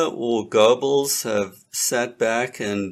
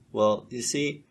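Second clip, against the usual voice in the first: ordinary talk, nothing marked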